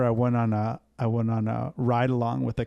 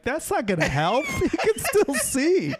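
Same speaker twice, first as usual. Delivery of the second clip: laughing disbelief; 14 semitones higher than usual. Real, not acted. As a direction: break into laughter at the absurdity. Retelling absurd cop plan, laughing.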